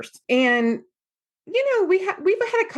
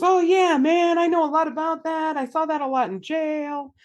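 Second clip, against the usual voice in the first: higher-pitched, friendly "been there" chatter; super-nice client; 8 semitones higher than usual. Retold or imitated. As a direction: imitate a very nice, easygoing group veteran. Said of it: "OG client was super nice and goes".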